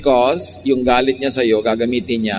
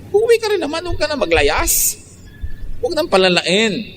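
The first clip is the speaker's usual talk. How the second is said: mocking scold; 13 semitones higher than usual. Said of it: "preacher mocking runaway spouse".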